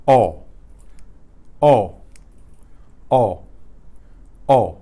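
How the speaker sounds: O as one clean vowel, no glide; loud, separate Spanish O each time; pure Spanish O. Shown as a demonstration. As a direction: say each O without any waviness. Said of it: "You hear it's a pure sound".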